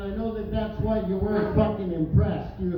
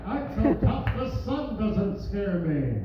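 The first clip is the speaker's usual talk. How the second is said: mock tough guy; 2 semitones lower than usual. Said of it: "Grown man wearing his ball cap backwards".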